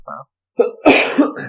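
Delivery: very slow, drawn-out fair enough; drawn-out concession. Shown as a demonstration. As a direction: stretch fair enough grudgingly.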